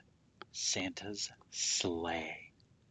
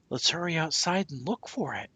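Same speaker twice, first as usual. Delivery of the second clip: put-on eager child; 9 semitones higher, 6 dB louder than usual. A narrator's reading. Child urging others to search.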